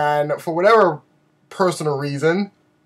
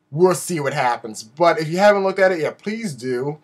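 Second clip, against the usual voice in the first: ordinary talk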